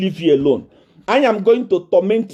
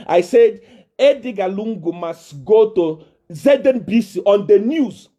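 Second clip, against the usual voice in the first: normal talking voice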